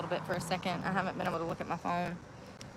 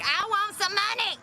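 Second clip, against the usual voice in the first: high squeaky put-on demand; mocking voice; 11 semitones higher than usual. Streamer mocking women who ask for money, squeaky voice. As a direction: whine it up high like a demanding caricature. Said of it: "Like them bitches be like".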